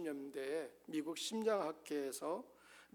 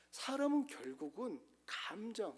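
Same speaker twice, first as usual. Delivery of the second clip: ordinary talk, nothing marked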